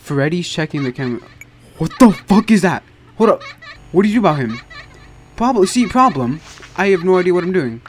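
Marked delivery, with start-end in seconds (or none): 1.8-4.6 startled. Real, not acted. Startled by game noise.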